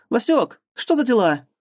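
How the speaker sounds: high, loud startled cry; alarmed exclamation. Acted. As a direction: shout it out in shock. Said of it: "воскликнула Кобра".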